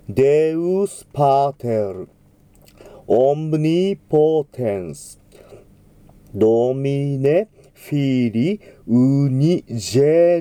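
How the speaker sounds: slow, drawn-out Latin model reading; slow model diction. Shown as a demonstration. Choir teacher reading Gloria text slowly. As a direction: pronounce each syllable slowly, clearly. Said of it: "AとEがくっついているときには".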